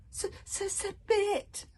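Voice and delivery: high stuttering moan. Acted. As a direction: moan it in defeat. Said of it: "moaned Toothless".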